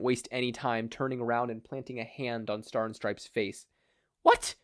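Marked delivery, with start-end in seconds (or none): 4.2-4.5 much higher, shocked outburst; shocked exclamation. Acted. Star exclaiming as she pries the hand off, high and shocked. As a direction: cry out in disbelief, high. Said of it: "Star exclaimed".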